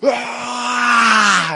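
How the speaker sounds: high, loud cry of sudden rage; rage shout. Retold or imitated. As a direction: let out a burst of fury. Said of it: "I just remember that thing and I go".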